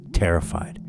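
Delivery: ordinary delivery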